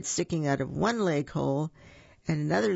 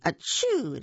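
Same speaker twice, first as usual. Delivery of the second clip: mock sneeze; 6 semitones higher than usual. A narrator's reading. Elephant sneezes into a jumbo hanky, mock sneeze. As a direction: act out a spoken sneeze.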